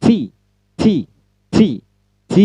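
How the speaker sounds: "T" diucapkan lebih tinggi dengan letupan udara; contoh T. Shown as a demonstration. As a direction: ulangi "T" dengan hembusan angin yang jelas. ("T" bernada tinggi dengan letupan udara)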